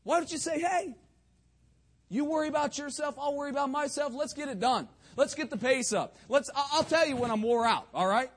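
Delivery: loud, high-pitched pulpit exhortation; rally the congregation loudly; forceful preaching. Real, not acted.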